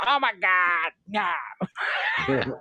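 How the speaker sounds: hammed-up Boston cry of ecstasy; mock orgasm in a broad Boston accent; Boston woman climaxing. Host quoting Ted Two, imitating a climaxing Boston woman. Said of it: "a Boston woman have an orgasm".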